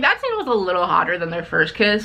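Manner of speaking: ordinary talk, nothing marked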